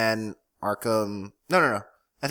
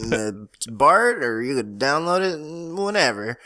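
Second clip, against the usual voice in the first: country twang. Pretend. Host slips from thug voice into a mild country twang. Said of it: "changed into, like, a country twang".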